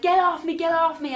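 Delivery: high, loud imitated cry for release; shouting out. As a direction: shout it like fighting off a grab. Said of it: "like, shouting out".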